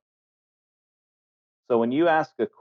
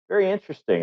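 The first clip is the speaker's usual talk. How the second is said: high, airy "Very interesting" as a polite brush-off; faintly bored polite dismissal; noncommittal very interesting; 8 semitones higher, faster than usual. Retold or imitated. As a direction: toss off "Very interesting" before changing the subject. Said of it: "I've gotten that a lot from people".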